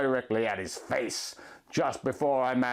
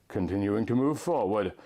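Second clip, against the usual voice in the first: ordinary delivery, nothing marked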